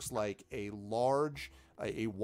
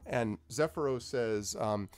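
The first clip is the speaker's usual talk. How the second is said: usual speaking voice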